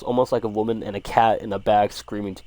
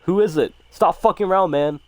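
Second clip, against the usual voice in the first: louder, higher acted yell; 9 semitones higher, 6 dB louder than usual. A narrator's reading. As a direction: call out in terror to whoever is outside. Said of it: "We keep yelling outside".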